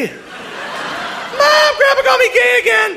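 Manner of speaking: tattling grandson. Retold or imitated. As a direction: yell it like a kid telling on grandpa. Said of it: "My grandson's gonna be like".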